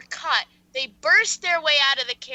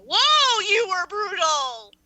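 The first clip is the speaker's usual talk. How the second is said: high, loud, slow exaggerated gasp; mock shocked; 7 semitones higher, 7 dB louder, much slower than usual. Real, not acted. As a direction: overplay the shock. Reacts to the story's violence.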